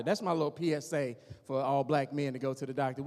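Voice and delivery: ordinary delivery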